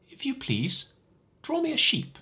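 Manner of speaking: put-on high, small child's voice asking politely; a little boy's odd, earnest request; high little prince voice, gentle plea. Odd little voice wakes pilot.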